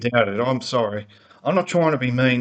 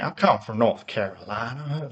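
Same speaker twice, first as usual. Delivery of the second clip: mock American accent; 4 dB quieter than usual. Showing viewers how the driver doesn't talk, in a mock American accent. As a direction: ham up a North Carolina American accent. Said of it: "doesn't even have an American accent".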